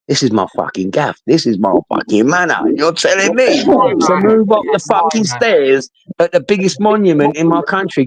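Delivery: retold protest, swelling into indignation; indignant rant. Londoner told to move off the stairs, indignant rant.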